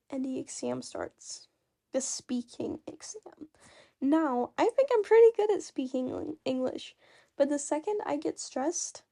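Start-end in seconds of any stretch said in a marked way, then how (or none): 0.1-3.5 hushed, dread-filled whisper; scared whisper. Retold or imitated. Telling friends about the exam, in a scared whisper. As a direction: breathe it like a ghost story.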